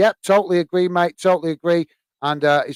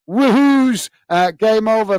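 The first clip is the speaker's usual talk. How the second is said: high, loud whoop on the first word; a burst of mock fan glee; excited cheer; 6 semitones higher, 3 dB louder, slower than usual. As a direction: read the cheer out like a fan celebrating. Reading out an excited chat cheer.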